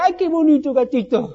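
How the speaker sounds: dulzón y más agudo, como a una mascota; tono tierno. Pretend. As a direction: mima al gato con voz cariñosa. Habla tierno al gato. (hablando con cariño al gato negro)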